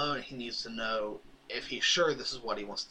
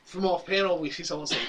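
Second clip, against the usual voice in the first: ordinary talk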